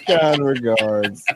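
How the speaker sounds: very slow, drawn-out mock-formal sign-off; mock polite sign-off. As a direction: drag it out with ironic politeness.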